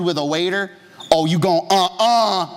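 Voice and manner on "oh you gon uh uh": mock sassy uh-uh, rising higher and louder; hammed-up head-shaking attitude; sassy refusal. Pastor mimics his own urge to slap rude diners. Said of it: "spirit of slap all over me".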